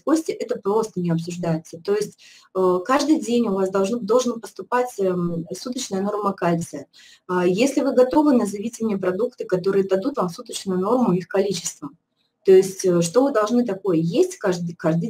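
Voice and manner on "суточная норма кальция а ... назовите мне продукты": usual speaking voice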